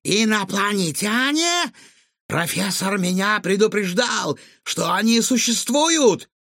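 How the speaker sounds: high-pitched, mounting astonishment; flabbergasted. A narrator's reading. Cook decides talking raccoons are aliens, flabbergasted. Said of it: "вытаращил глаза Чингисхан".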